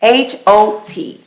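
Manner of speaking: each letter of H O T said slowly and separately; spelled out slowly. Real, not acted.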